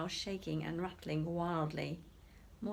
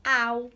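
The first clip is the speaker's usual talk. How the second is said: loud ow; 4 semitones higher, 12 dB louder than usual. A narrator's reading. Narrator voices the splat.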